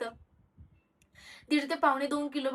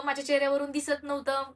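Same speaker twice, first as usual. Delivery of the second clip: ordinary talk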